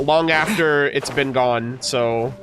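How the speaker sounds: ordinary talk, nothing marked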